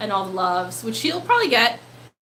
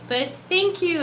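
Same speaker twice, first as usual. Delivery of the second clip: ordinary talk, nothing marked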